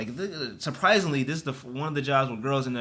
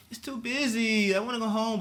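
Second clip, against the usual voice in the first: whiny coworkers; 7 semitones higher than usual. Retold or imitated. Imitating complaining girls.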